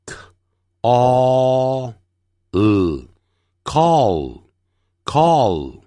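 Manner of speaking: k, a, l said apart, then call; very slow call with tongue tip up on the final L; sounding out. Shown as a demonstration. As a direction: stretch each sound of call, then blend. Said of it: "舌尖一定要翘起来".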